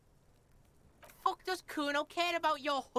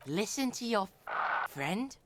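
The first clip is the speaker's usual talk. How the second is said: lower, menacing delivery; 7 semitones lower than usual. Pretend. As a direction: growl it like a villain. Repeats line in low voice.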